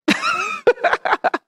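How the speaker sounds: quick high laugh at the disciples; amused burst of laughter; short amused laugh. Real, not acted. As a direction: let a laugh slip out.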